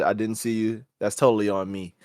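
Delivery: soft apology. Retold or imitated.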